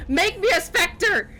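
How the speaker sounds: high, drawn-out mock pleading; imitating Conrad. Player recalling an eager character's demand, mocking imitation. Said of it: "the guy that was like".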